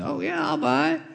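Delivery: high, eager agreement; eager kinsman. Acted. The kinsman eagerly agrees to buy the land, high and quick. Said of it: "And he said".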